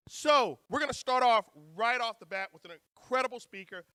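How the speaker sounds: ordinary delivery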